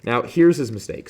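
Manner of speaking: ordinary talk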